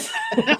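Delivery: short high laugh. Real, not acted. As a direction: burst out laughing. Laughs after smart aleck joke.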